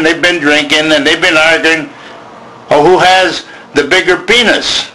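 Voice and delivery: normal talking voice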